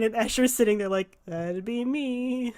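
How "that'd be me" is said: very slow, much softer flat admission; deadpan. Acted. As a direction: drop it dryly into the silence. Escher answers deadpan.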